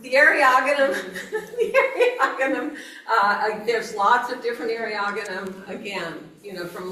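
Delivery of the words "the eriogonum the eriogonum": high-pitched, laughing through the words; real leftover giggles lifting the voice high; giggly. Real, not acted. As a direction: try to carry on while still laughing. Recovering from a laughing fit.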